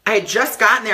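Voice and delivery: ordinary delivery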